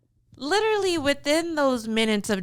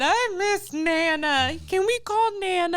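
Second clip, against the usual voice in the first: mild whiny kid impression; 7 semitones higher than usual. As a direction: plead like a sad little boy. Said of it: "He was still sad".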